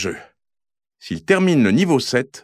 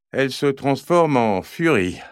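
usual speaking voice

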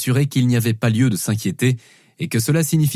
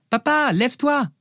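put-on high-pitched child's voice calling Papa; little girl calling out; 12 semitones higher than usual. Daughter waking Dad in bed, high child voice. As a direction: call out like an impatient little daughter.

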